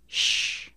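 sh hissed alone, unvoiced; just the sh, no vowel; isolated sh sound. Shown as a demonstration. Teacher isolating the sh sound. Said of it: "Repeat after me".

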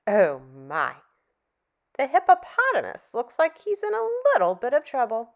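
normal talking voice

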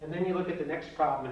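normal talking voice

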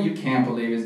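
usual speaking voice

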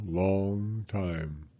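usual speaking voice

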